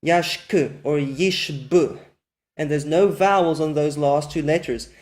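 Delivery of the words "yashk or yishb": Yashk and yishb said very slowly, ending on two bare consonants with no vowel; slow demo words. Shown as a demonstration. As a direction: stretch out Yashk and yishb and stop dead on the final consonants. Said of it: "two silent schwas at the end of a word".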